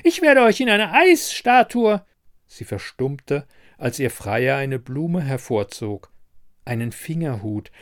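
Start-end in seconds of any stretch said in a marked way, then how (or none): 0.0-2.0 loud, high-pitched angry threat; high, furious screeching; shrewish threat. A narrator's reading. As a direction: threaten them like a raging ice queen. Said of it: "keifte sie".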